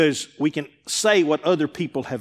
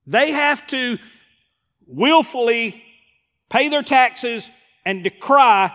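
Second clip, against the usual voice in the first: rising sermon; 7 semitones higher, 3 dB louder than usual. Real, not acted. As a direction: build the sermon toward its peak. Preaching builds up.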